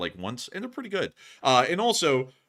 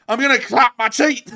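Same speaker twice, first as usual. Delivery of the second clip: much higher, louder, laughing; excited shout; 13 semitones higher, 10 dB louder than usual. Real, not acted. Host shouting back at his co-host's teeth joke, laughing. Echoing co-host's teasing line.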